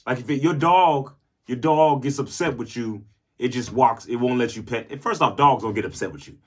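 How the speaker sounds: ordinary delivery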